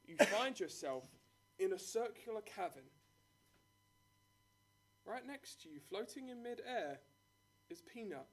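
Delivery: dramatic scene-setting narration; game master narration. Acted.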